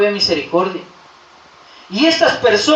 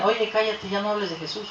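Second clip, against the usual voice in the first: much softer, confidential aside; hushing someone; 10 dB quieter than usual. Acted. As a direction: hush them under your breath. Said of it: "imagínense que a usted le dijeran". (callar a alguien en voz baja)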